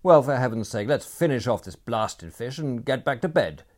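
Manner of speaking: loud, exasperated complaint; irritated. A narrator's reading. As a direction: grumble it loudly and impatiently.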